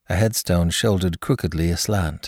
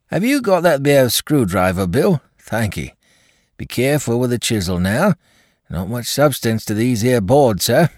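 high, loud working-class labourer; a gruff gravedigger calling to his mate; rough workman voice; 7 semitones higher, 5 dB louder than usual. Acted. Workman speaks at the coffin.

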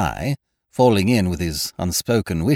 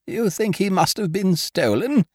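high-pitched anxious question; alarmed lady; 12 semitones higher than usual. A narrator's reading. Hostess realising her parrot may be stolen, high and alarmed. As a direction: ask it high, in dismay.

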